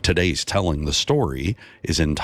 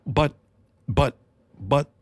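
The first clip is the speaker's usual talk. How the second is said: put-on stammering boy; 9 semitones higher than usual. A narrator's reading. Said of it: "the boy stammered".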